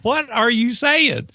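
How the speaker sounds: high, slow incredulous question. Retold or imitated. Telling the group he can't believe their advice, high and slow. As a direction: disbelief at the group's verdict.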